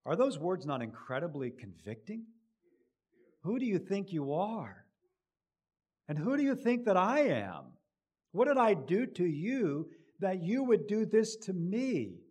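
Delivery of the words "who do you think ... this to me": rising, hurt and indignant rebuke; indignant Abimelech. Acted. King rebuking Abraham for his lie, indignant. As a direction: confront him with the wrong done to you. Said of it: "This is what Abimelech is saying to him".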